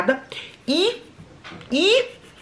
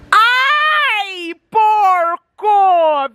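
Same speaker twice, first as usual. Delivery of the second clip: high, drawn-out yell of disgust; loud, high scolding wail; disgusted outcry; 10 semitones higher, 5 dB louder, much slower than usual. Real, not acted. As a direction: wail loudly in disgusted protest. Reacting to a fart nearby.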